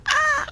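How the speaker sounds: short high yelp of frustration; high shriek. Real, not acted. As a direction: let out a sharp high squeal. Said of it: "I'm gonna scream".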